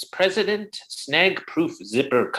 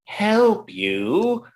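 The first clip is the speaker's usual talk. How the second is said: scoffing, higher; incredulous; 7 semitones higher than usual. Acted. Boss scoffs at the secretary's plea for help, incredulous. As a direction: echo her plea in disbelief.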